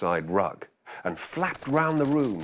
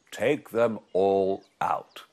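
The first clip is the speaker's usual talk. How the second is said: put-on wise old sage; 4 semitones lower, much slower than usual.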